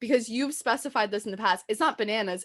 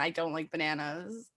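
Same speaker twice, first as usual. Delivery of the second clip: softer, shy imitation of bananas; quiet retold confession; 5 semitones lower, 9 dB quieter than usual. Retelling her friend's confession, softly and shyly. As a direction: murmur it like a shameful secret. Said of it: "then she's like".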